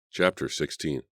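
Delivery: ordinary delivery, nothing marked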